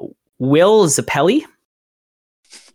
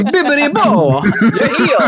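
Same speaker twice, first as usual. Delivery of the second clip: laughing through the spell; 8 semitones higher than usual. Retold or imitated. Quoting the mystic's healing spell.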